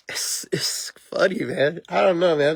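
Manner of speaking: helpless real laughter squeezing the words; cracking up. Real, not acted. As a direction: wheeze it out high through laughter. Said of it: "just over here laughing my ass off".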